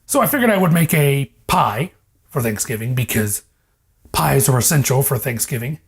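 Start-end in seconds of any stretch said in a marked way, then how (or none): none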